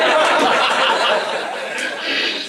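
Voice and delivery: loud, high burst of laughter; cracking up at his own joke; hearty real laugh; big high laugh. Real, not acted.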